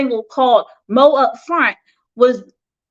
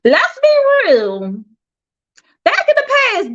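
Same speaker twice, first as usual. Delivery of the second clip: knowing tease; 10 semitones higher than usual. Real, not acted.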